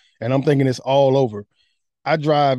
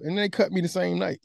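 ordinary talk, nothing marked

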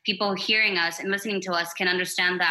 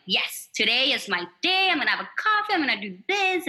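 bright, much higher pitch, pumped; upbeat go-getter; 5 semitones higher than usual. Retold or imitated. Guest imitating her own good-morning self, excited and high. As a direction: sell the good-day energy. Quotes herself on good days.

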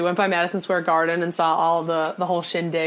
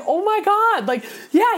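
much higher, stunned gasp of a reply; amazed clerk; 12 semitones higher than usual. Retold or imitated. The store clerk amazed she knew the player, much higher. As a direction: play the clerk blown away.

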